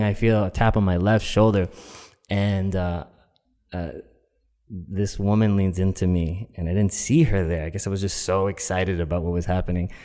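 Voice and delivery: usual speaking voice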